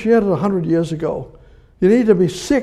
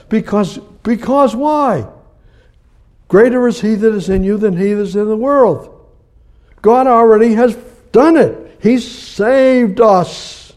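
high, loud, building preaching; preaching at full force; 5 semitones higher, 5 dB louder than usual. Real, not acted. Preacher urging the church toward God, loud and high. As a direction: lift it up and drive it home.

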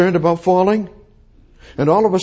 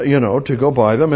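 usual speaking voice

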